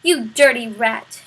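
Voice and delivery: loud insult thrown at the rat; angry shout. A narrator's reading. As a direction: spit it out furious. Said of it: "shouted the prisoner".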